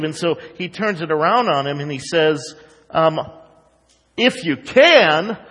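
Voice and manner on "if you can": slow, raised, louder incredulous echo; sarcastic. Retold or imitated. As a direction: throw it back as a mocking question. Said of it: "sarcastic remark".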